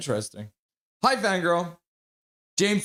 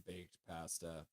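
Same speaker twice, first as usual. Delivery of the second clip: much softer and lower, slow and savoring; 9 semitones lower, 24 dB quieter than usual. Acted. Streamer dreamily savors the idea of baked pasta, hushed and slow. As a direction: murmur it slowly, savoring the thought.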